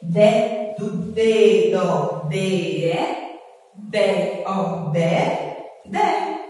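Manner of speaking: slow, stretched "dé" before the word; drawn-out syllables. Shown as a demonstration. Teacher linking syllable to picture word, drawn-out syllables. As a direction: sound out "dedo" slowly for learners.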